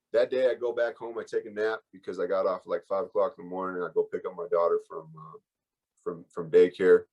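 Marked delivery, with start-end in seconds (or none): none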